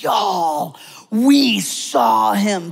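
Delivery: high, slow, bursting with news; excited shepherds. Acted. Shepherds announcing to townspeople, excited and slow. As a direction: shout the news like a stunned shepherd.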